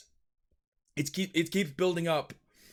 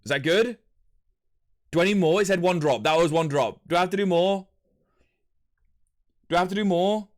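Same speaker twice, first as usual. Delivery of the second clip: louder questioning; 3 semitones higher, 6 dB louder than usual. Real, not acted. Excitedly asking chat about drops.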